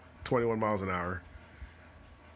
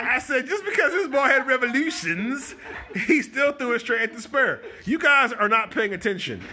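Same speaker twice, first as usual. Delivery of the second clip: laughing; 12 semitones higher, 7 dB louder than usual. Real, not acted. Laughing while defending his point.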